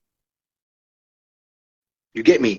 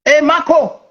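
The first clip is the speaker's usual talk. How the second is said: mock hustler greeting, pitched higher; playful street-trader impression, higher; street-slang big-money trader; 10 semitones higher than usual. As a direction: call out to your buddy like a slangy trader. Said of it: "don't you think they talk like that?".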